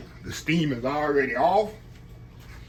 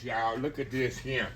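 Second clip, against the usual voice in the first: usual speaking voice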